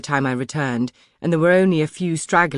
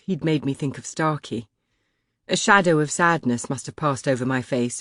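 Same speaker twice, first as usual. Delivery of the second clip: usual speaking voice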